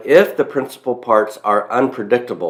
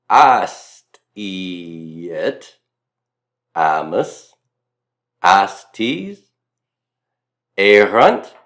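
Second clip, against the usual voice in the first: Southern drawl repeat; much slower than usual. Shown as a demonstration. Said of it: "it sounds something like this".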